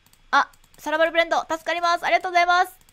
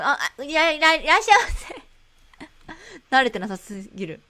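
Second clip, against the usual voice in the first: ordinary talk, nothing marked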